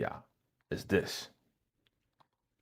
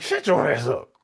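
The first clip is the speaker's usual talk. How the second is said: higher-pitched mock scolding; imitated telling-off; 5 semitones higher, 4 dB louder than usual. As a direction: cut him off as the fed-up wife. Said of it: "She was going in on your boy".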